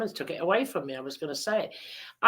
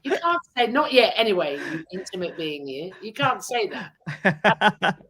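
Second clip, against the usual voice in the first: high, loud laughing mock outrage; laughing tease; 3 semitones higher, 6 dB louder than usual. Real, not acted. Host joking to guest, laughing mock scandal. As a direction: tease him with fake shock.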